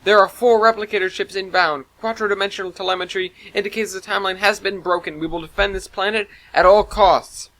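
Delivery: high, loud battle alert; firm orders to the whole crew; urgent AI announcement; commanding announcement. A narrator's reading. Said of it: "Cortana commanded".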